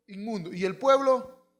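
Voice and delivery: ordinary talk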